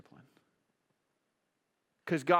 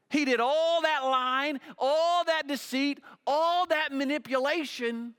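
higher and louder, hammering each all; forceful preaching; 12 semitones higher, 7 dB louder than usual. Acted. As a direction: preach it hard, driving the point home.

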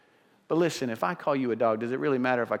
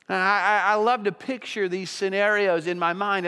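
raised, pleading pulpit voice; louder, higher sermon build; impassioned preaching; 7 semitones higher, 3 dB louder than usual. Acted. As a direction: preach it with rising passion.

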